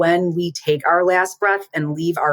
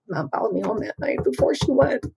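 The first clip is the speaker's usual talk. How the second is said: tearful, high, cracking quote; voice breaking; 8 semitones higher, 4 dB quieter than usual. Real, not acted. Sister retelling her brother's words, voice breaking. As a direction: voice catching on tears as she quotes him. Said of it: "Doing it again".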